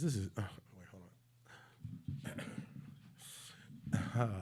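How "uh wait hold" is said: normal talking voice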